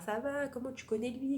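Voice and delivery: imitated chattiness. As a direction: mimic people striking up friendly small talk. Imitating friendly small talk between strangers.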